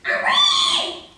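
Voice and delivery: very high, loud piggy squeal. A narrator's reading. Trapped pig squealing in pain, very high loud squeal.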